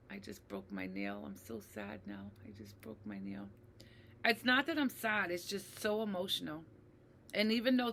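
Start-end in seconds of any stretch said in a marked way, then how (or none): 0.1-3.5 very quiet, low fake pouting; tiny, low mock-sob aside; mock sad. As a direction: pretend to be heartbroken under your breath. Jokingly fakes being sad mid-story.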